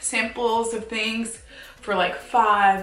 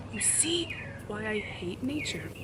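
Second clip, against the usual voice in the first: ordinary talk, nothing marked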